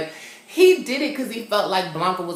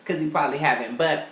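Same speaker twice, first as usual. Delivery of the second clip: ordinary talk, nothing marked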